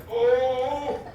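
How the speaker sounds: as if your stomach just turned on you; hammed-up panicked discovery; alarmed realization. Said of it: "he was like".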